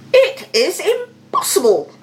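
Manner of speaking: high, loud outburst of disbelief; shocked cry. A narrator's reading. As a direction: cry out in outrage. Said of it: "cried the king".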